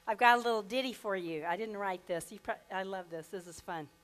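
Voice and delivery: ordinary delivery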